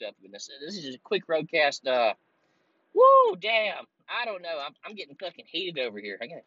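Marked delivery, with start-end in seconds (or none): none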